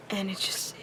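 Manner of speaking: breathy under-the-breath aside; whisper. Real, not acted. Ranting vlogger to viewers, suddenly whispering. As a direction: drop to a whisper mid-rant.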